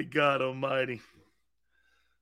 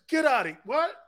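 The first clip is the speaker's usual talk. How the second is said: retold incredulity, pitched higher; mock disbelief; 8 semitones higher than usual. Grandfather hearing that [name] is part Italian, mock disbelief. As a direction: brush it off, then a high rising "what". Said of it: "And he goes like this".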